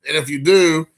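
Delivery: ordinary talk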